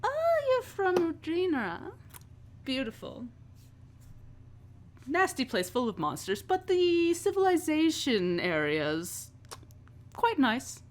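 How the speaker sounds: high, theatrical society chatter; high-pitched, airy grande-dame manner; effusive boss Vera. Acted. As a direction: react with lofty, delighted interest. Plays Vera hearing of hometown.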